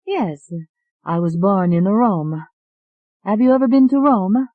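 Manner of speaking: put-on high, bright-eyed Italian accent asking. Alette asking the doctor about Rome in an Italian accent. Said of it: "You have an Italian accent".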